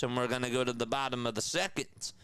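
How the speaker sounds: ordinary delivery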